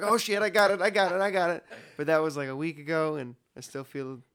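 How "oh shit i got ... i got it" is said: loud, high panicked blurt. Retold or imitated. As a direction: freak out that you're sick. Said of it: "and I was like".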